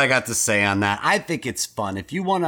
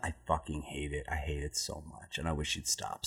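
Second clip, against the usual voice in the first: much softer, lower grumble; quiet venting; 8 semitones lower, 15 dB quieter than usual. Real, not acted. The host complains about the song at the movie's intro, quiet and low. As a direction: mutter the hatred under the breath.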